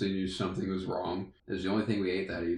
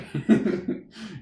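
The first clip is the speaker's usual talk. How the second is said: a burst of high laughter; loud laugh; 10 semitones higher, 5 dB louder than usual. Real, not acted. Friend laughing hard while telling the story.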